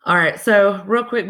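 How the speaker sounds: ordinary talk, nothing marked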